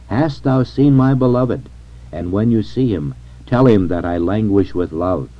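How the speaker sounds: ordinary talk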